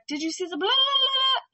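high, loud yelled quote over rushing water; shouting from a cliff. Retold or imitated. The lady on the cliff yelling down at the fishermen, high and loud. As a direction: call up across the river. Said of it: "she's like".

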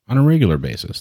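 ordinary delivery

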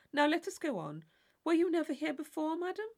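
high, loud, overly eager questioning; mock polite inquiry. A narrator's reading. Tilney playing the attentive partner to Catherine. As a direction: ham up the dutiful partner's questions.

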